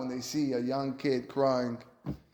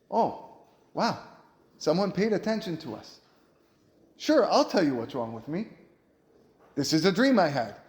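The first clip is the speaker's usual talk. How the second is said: eager relief; 5 semitones higher, 4 dB louder than usual. Acted. Jailmates thrilled someone cared.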